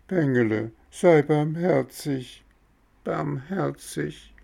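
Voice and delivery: high, whining, fading on the last barmherzig; howling plea. Acted. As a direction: wail for mercy. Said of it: "So heulten die zwei Räuber".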